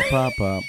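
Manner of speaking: very high squeaky pop sounds; firecracker pops. Retold or imitated. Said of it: "mimicking popping sounds".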